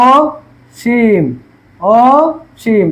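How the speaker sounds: অসীম drawn out very slowly, first অ like ও; slow pronunciation demo. Shown as a demonstration. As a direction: stretch অসীম right out for the class. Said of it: "অসীমটা বলছি". (অসীম খুব টেনে টেনে, অ-কে ও-এর মতো)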